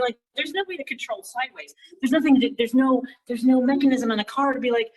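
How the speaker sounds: ordinary talk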